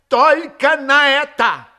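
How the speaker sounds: high, loud, slow desperate insistence; fervent climax. A narrator's reading. Repeat, higher and louder.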